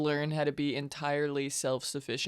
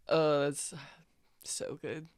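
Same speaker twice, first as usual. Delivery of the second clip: ordinary talk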